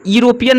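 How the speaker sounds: teacher's mistaken reading of "यूरोपियन", starting "iro-"; wrong pronunciation. Shown as a demonstration. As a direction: say "यूरोपियन" as "ee-ropian". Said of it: "यूरोपियन नहीं पढ़ते हैं".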